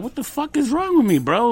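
high-pitched, exasperated self-questioning; retold exasperation. Man recalls asking himself why he cried at night, exasperated.

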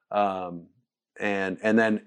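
ordinary talk, nothing marked

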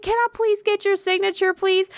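high-pitched, excited retold request; eager fan plea. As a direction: plead like a starstruck fan. Nervous fan asking for signature.

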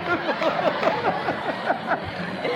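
high, helpless laugh running on. Real, not acted. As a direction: keep laughing, unable to stop. Host keeps laughing.

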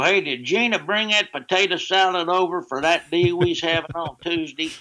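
a light hint of a Southern good ol' boy; Southern yokel. Friend Jeff riffing at the Braves game, light Southern accent. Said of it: "he'd go".

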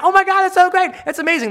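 replying to a fan's kale photo with hammed-up gushing; gushing praise. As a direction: overplay your delight for their sake.

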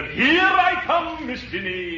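high, slow melodrama entrance; high slow stage voice. Acted. Villain enters the stage.

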